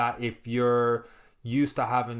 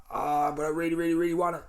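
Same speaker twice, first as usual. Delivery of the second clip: put-on high, pleading voice, like a craving kid; whiny craving; 6 semitones higher than usual. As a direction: beg for the treat, mock-whiny. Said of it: "I'm like".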